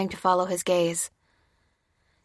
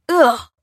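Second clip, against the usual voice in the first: high, loud "ugh"; recoiling, horrified exclamation; cry of horror; 7 semitones higher, 10 dB louder than usual. A narrator's reading. Bearded man splattered with blood, horrified cry. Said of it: "cried the bearded man in horror".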